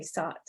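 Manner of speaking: "sot" with a plain s in place of sh. Shown as a demonstration. Lecturer showing UP speech, "sot" with s for sh. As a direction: say "sot" for "shot", with s at the front. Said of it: "you might hear people say".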